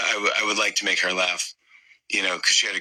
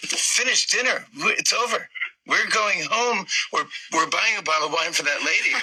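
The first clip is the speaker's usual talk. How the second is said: high-pitched, exasperated disbelief; mock-outraged and high, putting a foot down; incredulous outburst; 8 semitones higher than usual. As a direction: retell an indignant refusal, voice pitched up. Incredulous reply to dad, retold.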